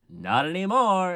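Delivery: hammed-up killer's cry; mock menacing shout. Sweeney about to murder old lady, mock shout.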